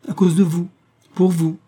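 embarrassed, high-pitched confession; Miss Pearl. A narrator's reading. Actress confessing her fear for James, sweetly embarrassed. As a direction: admit it shyly, voice lifted high. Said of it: "avec un délicieux embarras".

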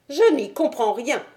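high-pitched, puzzled gentleman; high, mildly troubled voice; bewildered Thaddeus. Acted. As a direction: voice a baffled little man up high. Uneasy Thaddeus voiced in story.

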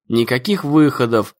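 usual speaking voice

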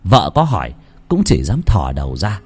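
plain reading voice

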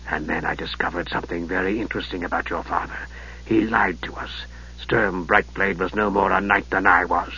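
low, soft, sinister mage; Raistlin's voice. Acted. Raistlin greeting his nephew, low and softly sinister. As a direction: hush it like a frail dark wizard.

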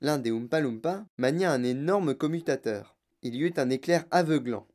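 ordinary delivery, nothing marked

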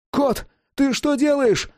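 high, drawn-out alarmed yell. A narrator's reading. Calm Adam finally yells at Cat in darkness, alarmed. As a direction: cry out the question in alarm. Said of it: "заорал Адам".

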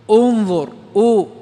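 "انظر" begun on a stressed, drawn-out "u"; hamza with damma. Shown as a demonstration. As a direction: say "انظر" slowly and loudly, then isolate its "u". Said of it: "فنقول". (نطق "انظر" بهمزة مضمومة ممدودة للتوضيح)

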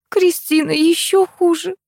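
high, slow, sighing complaint; drawn-out weary lament. A narrator's reading. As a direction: sigh it out slowly in a high voice. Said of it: "вздохнула Тамара".

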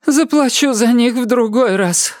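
put-on very high, distressed old lady voice; high character voice of a rattled old woman; shaken elderly woman. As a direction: lament in bewilderment, pitched high. Elderly woman speaks after the fall.

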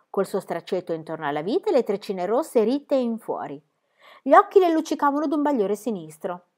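plain reading voice

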